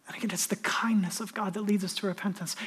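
hushed whispered truth; preacher whispering about God's kindness. Acted. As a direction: whisper it to the room. Whispered sermon line.

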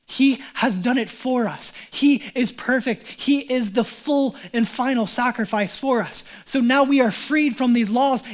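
slow, loud, emphatic preaching; solemn fired-up proclamation; preaching at full force. Acted. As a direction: lay down each word to the crowd.

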